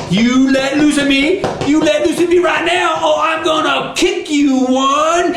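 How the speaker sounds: high, indignant threat, building; angry Brer Rabbit. Acted. Rabbit threatens the one holding him, high and angry. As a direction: demand release in a high, mad voice.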